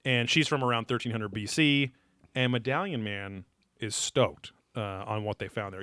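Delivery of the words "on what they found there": normal talking voice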